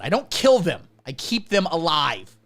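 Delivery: normal talking voice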